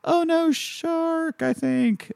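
very high, slow, drawn-out fake panic; mock alarm. Mocking the hokey shark scene, high slow fake alarm. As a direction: draw out a half-hearted "oh no" way up high.

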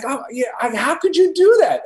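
high, loud disbelieving cry; mock-betrayed protest, high and loud; incredulous reproach. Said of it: "And I was like".

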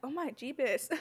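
Oh my, Jeebus with JEE-bus for Jesus; mock exclamation, Jeebus as JEE-bus; saying Jeebus. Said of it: "I've been saying Jeebus".

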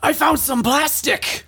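high, strained anime villain voice; Vegeta. Acted. Host performing the photo caption on request, Vegeta impression. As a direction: put on a high, forceful cartoon warrior. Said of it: "can you throw in Vegeta for this one?".

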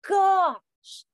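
high, loud oh my gosh; shocked gasp. Retold or imitated. Mom realizing her teen copied her habit, shocked. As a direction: burst out in amused shock. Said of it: "I'm like".

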